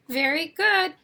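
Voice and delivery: normal talking voice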